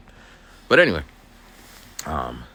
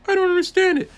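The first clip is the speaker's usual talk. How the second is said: put-on much higher, louder whining kid; whiny student voice; 8 dB louder than usual. Student complaining to the aide about math, in a loud high whine.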